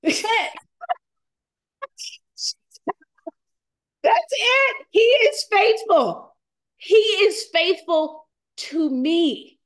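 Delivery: fighting back real tears; on the verge of tears. Real, not acted. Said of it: "I'm gonna start crying".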